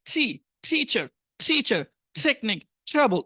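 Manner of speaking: টিচার slowly with an English t, neither ট nor ঠ; English t sound. Shown as a demonstration. Teacher showing English t to students. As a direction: stretch out টিচার and টেকনিক with a true English t. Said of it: "টিচার, এটাও তো সঠিক না".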